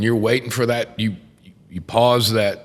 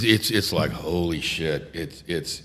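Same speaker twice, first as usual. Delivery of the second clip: ordinary delivery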